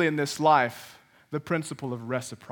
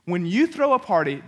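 usual speaking voice

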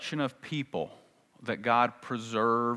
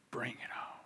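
whispered bring it on; 16 dB quieter than usual. Acted. Hypothetical bold Elijah.

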